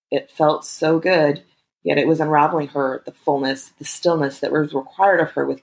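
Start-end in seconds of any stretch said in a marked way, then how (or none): none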